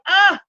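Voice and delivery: short high yelp of panic, loud; panicked cry. Retold or imitated. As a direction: blurt a sudden high "ah" as everything collapses. Panicked call, overwhelmed.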